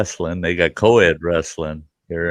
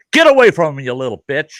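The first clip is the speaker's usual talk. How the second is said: snarling father, first words shouted high and loud; an angry father yelling at a girl; imitated furious dad; retold angry dad; 7 semitones higher, 5 dB louder, faster than usual. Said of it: "he goes".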